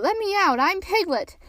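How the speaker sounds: high-pitched protesting cry. A narrator's reading. Piglet wanting out of the bath, high cry. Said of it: "cried Piglet".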